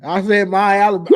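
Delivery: usual speaking voice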